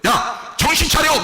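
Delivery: high, sharp acted call; scolding wake-up call. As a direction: call someone back to their senses.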